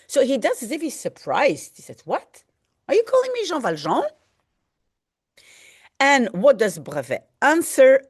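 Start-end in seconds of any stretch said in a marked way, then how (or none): 2.0-4.0 quick, higher mock astonishment; feigned surprise. Accused man feigning surprise to the jailer, quick and high. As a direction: act out playing dumb, fast. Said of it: "he plays the surprised one".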